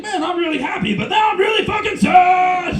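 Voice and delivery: high-pitched burst building to a loud mock-anguished shout; exaggerated mood flip, ending in a yell; happy then sad. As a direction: swing from fake joy into hammed-up misery. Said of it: "pretend to be happy and then really sad".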